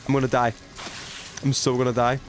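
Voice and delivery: ordinary talk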